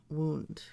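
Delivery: "wound" with oo; wound as WOOND. Shown as a demonstration. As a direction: say "wound" to rhyme with "crooned". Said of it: "I would say".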